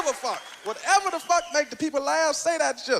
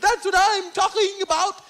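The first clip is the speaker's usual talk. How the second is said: loud, slow angry lecture; angry scolding; 4 semitones higher, 3 dB louder, slower than usual. Retold or imitated. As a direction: scold him loudly, drawn out.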